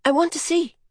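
put-on high, insistent child's plea; urging. A narrator's reading. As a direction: plead in a high, light voice. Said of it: "she urged Horse".

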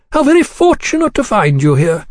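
high, suavely courteous greeting; suave gentleman. Acted. Gentleman arriving at the diner's table, suavely courteous. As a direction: greet him with polished, high-pitched courtesy. Gentleman feigns pleasure at cornering acquaintance.

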